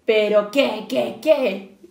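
loud, rising alarm from the fox; shouted. Acted. Fox shouts at the piglet in alarm, loud. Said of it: "gritó el zorro".

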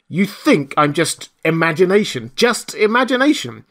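indignant challenge. A narrator's reading. The voice to disbelieving Marvel, indignant.

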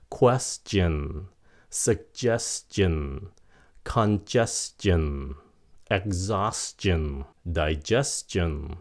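slow word list, "stion" said s-chin; "s-chin" endings. Shown as a demonstration. Teacher gives examples, "stion" as slow s-chin. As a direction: hiss the s, then chin in each "stion". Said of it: "đi sau chữ S là shin".

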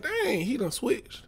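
high-pitched, stunned disbelief; shocked disbelief. Retold or imitated. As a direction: ask it like you can't believe he left you. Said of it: "I'm like".

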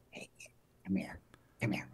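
very soft, low beckoning; confidential Mickey Rooney. Retold or imitated. Mickey calls his co-star over before the take, hushed. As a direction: draw him aside under your breath. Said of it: "Mickey looks at me and he goes".

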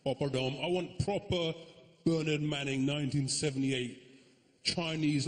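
ordinary talk, nothing marked

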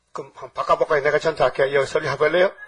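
ordinary delivery

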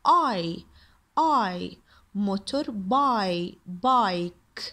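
I said alone as "eye", then in motor by; long I demo. Shown as a demonstration. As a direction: build bike slowly from "by" to "bike". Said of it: "يعني صوته كاسمه".

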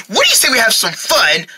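ordinary talk, nothing marked

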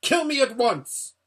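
high, loud howled demand; humiliated prince howling up high; howling challenge. A narrator's reading. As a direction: howl it in outraged defiance. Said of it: "howled Rabadash".

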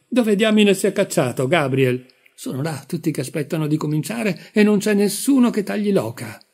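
high, loud flustered complaint; exasperated shout. A narrator's reading. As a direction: scold the room in a fluster. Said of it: "gridò".

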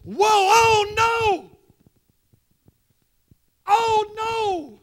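high, loud, stretched wail of oh no; Isaiah's wail before God, preached loud; anguished cry. Acted. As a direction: cry it out like a man undone. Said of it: "When he says".

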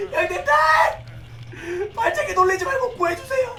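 high, whiny plea to the team; calling teammates in a high, hammed-up beg; mock begging. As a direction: whine up high like a pleading kid. Begging teammates to rescue him.

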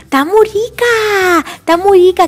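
put-on delighted toddler, drawn-out baby talk; little George. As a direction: savour it like a thirsty small child. Little George enjoys the water.

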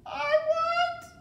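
very high, loud wail; sobbing cry. A narrator's reading. Mig sobbing out her wish, high wail. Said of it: "sobbed Mig".

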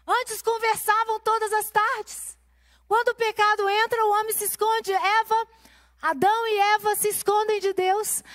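high, pressing preacher's voice; pregação inflamada. Acted. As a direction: preach it out to the women, rising. Opening sermon point, raised voice. (pregação em voz alta e intensa)

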